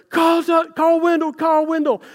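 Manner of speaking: high, slow, pained cry for help; desperate shout. Retold or imitated. Husband in agony shouting to wife Jen, high desperate cry. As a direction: yell for the doctor through the pain. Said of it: "screaming to Jen".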